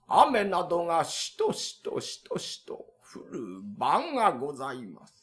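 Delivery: hushed, drawn-out ghost-story telling; slow kaidan narration. Acted. The tenant spooking the landlord with a slow hushed tale.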